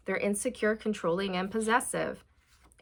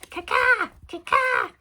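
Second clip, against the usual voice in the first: high, loud cawing crow; crow impression; 14 semitones higher, 6 dB louder than usual. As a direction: caw out like a crow. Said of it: "That's my crow impression".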